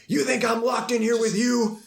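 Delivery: high, slow menacing yell; Rorschach yell. Retold or imitated. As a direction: bellow it slowly like a threat. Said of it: "Rorschach yells".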